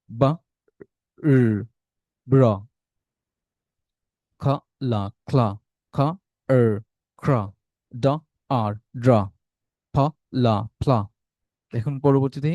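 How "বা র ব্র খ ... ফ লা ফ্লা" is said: each sound alone, then joined, as in "বা, র, ব্র"; blending demonstration. Shown as a demonstration. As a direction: model "খ, লা, ক্লা" piece by piece, pausing for repeats. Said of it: "আমি পড়ে দিচ্ছি, আমার সাথে রিপিট করুন". ("ফ, লা, ফ্লা" আলাদা করে, তারপর জুড়ে পড়া)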